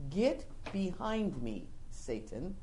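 slow, soft and low; low stern rebuke. Retold or imitated. Jesus rebuking Peter, slow low stern rebuke. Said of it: "he goes on to say".

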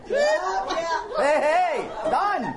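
triumphant. Pretend. Crossing off her to-do list.

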